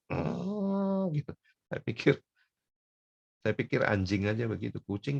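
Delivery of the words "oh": high, drawn-out cat noise; imitated cat yowl. Cat guarding fish from another, long high yowl. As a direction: imitate a cat warning off a rival. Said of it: "dia langsung".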